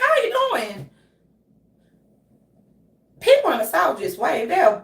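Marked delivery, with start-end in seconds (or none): 0.0-0.9 high, loud over-friendly greeting; hammed-up cheery hello, pitched way up; exaggerated eager friendliness in a high voice; Billy Bob. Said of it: "Billy Bob will be like".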